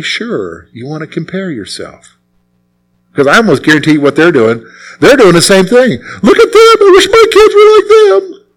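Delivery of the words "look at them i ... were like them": much higher mock voice of an envious parent; hammed-up high whine, wishing aloud. Parent eyeing another family, in a high mock whine.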